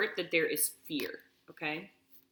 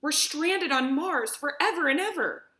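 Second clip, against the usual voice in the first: put-on high, loud, despairing young daughter; weeping girl; 10 semitones higher, 5 dB louder than usual. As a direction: wail it as if all hope is gone. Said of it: "Laura wept".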